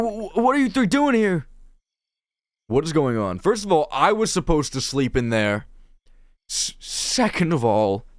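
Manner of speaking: high, loud, stammering bewilderment; flustered game character. Acted. Caught off guard by three unexpected visitors, flustered. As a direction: sputter in startled indignation.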